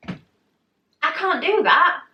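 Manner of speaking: high, drawn-out mock-panicked protest. Retells refusing the lift.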